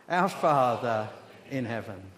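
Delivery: loud, measured lead for a congregation; leading group recitation. Shown as a demonstration. Preacher leading the church in prayer, loud and deliberate. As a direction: say it out firmly for all to join. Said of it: "Let's just say it again".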